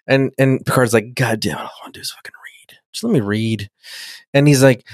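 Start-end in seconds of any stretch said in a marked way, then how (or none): 1.1-3.7 whispered mock exasperation; imitated fed-up man griping under his breath; exasperated whisper. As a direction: grumble in a whisper to yourself.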